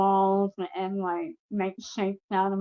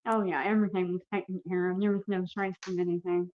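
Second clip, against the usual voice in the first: usual speaking voice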